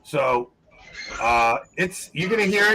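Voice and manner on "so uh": ordinary delivery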